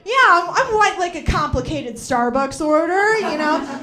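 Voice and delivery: loud, high, bubbly, self-satisfied; hammed-up basic girl ordering coffee. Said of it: "walking around like".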